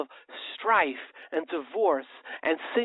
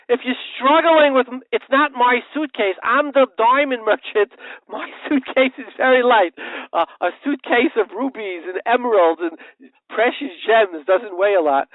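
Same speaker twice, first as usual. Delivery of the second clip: louder, higher protest; hollering merchant; 7 semitones higher, 7 dB louder than usual. Retold or imitated. Diamond merchant hollering at his neighbour, louder and higher. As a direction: holler it back at him. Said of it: "starts screaming and he hollers".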